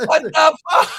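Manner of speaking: high, loud yelp of disbelief; real high-pitched outburst; incredulous shout. Real, not acted. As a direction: yell it in amused shock. Outburst amid laughter.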